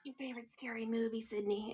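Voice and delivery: hushed, quick menacing murmur; very soft creepy phone voice; Ghostface. Pretend. Ghostface quizzing Sydney by phone, hushed menace. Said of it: "He's like".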